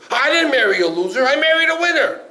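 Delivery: husband protesting to the rabbi that his wife is no loser, indignant. Acted. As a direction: bristle and fire it back.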